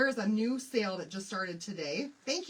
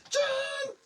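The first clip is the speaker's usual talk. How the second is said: calling out; 5 dB louder than usual. Real, not acted. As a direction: shout the name high across the room. Calling out to someone.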